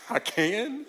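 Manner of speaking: high, rising disbelief, played for laughs; mock astonishment. Married pastor answering a single guy, mock astonishment. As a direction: act amazed at unexpected good news.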